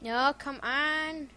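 normal talking voice

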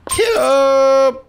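loud, high drawn-out yell; game yell. Real, not acted. Player yelling at the race, loud battle cry.

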